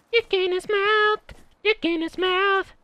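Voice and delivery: very high squeaky mock voice, repeated; mocking silly voice. Gamer taunting the enemy in squeaky mock voice.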